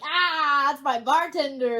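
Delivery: loud, excited squeal of recognition; mock excited customer. Customer spotting the bartender out in public, mock excited. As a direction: exclaim it like an over-thrilled regular.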